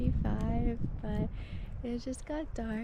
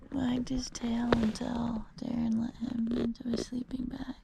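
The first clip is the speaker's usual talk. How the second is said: soft early morning whisper; 6 dB quieter than usual. Real, not acted. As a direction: whisper so nobody wakes. Early morning, others sleeping.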